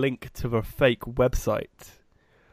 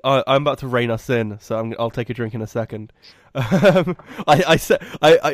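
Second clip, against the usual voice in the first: usual speaking voice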